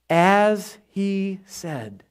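very slow, word by word; slow, measured emphasis; drawn-out reminder. Acted. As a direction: stretch each word out deliberately. Drawing out the angel's reminder.

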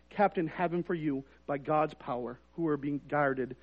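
soft, hushed reading of the verse; quiet scripture reading. Real, not acted. Pastor reading scripture to the congregation, hushed and soft. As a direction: read it softly, almost to yourself. Reads scripture passage softly.

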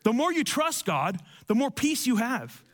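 normal talking voice

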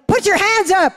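high, forceful order; bold command. Retold or imitated. Charismatic preacher ordering the doctor to stand, forceful. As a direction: command him to his feet. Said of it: "my charismatic side came in to me".

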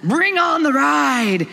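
high, loud, slow thrill-ride yell; excited scream. Retold or imitated. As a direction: shout out the joy of the ride, hands in the air. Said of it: "screaming like".

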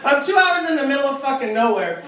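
normal talking voice

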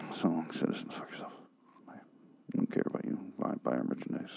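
very soft, low, near-whispered kiss-off; hushed, dismissive sign-off as the episode; show's mock goodbye. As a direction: murmur a contemptuous farewell almost under your breath. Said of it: "the goodbye that the episode would give you if they could speak to you directly".

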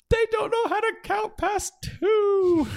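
very high, slow, loud squeaky dwarf; gully dwarf voice. Retold or imitated. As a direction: play a dim little dwarf for the child.

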